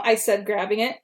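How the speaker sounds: usual speaking voice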